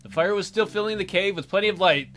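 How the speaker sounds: normal talking voice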